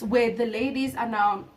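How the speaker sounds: ordinary talk, nothing marked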